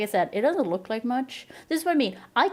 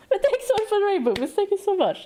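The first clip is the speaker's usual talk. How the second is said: bubbly thanks; 11 semitones higher, 3 dB louder, faster than usual. Real, not acted. Thanking a subscriber.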